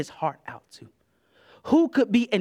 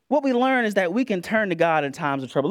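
ordinary talk

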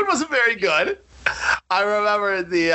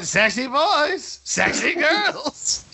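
imitating Francois's leer; 4 semitones higher, much slower than usual. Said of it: "Francois kept going".

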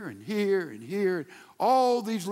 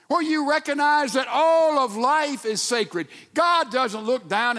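higher and louder, ringing out; preaching shout; 5 semitones higher, 3 dB louder than usual. Real, not acted. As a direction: proclaim it to the back row. Preacher rises to a climax.

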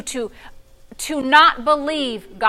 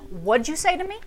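half-laughing mock challenge; 3 semitones higher, 5 dB quieter than usual. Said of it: "you do not say no to your mother".

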